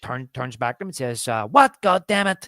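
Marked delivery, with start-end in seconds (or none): 1.5-2.5 high, loud "What?", then a grumbled curse; exasperated outburst. Retold or imitated. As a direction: snap back, fed up.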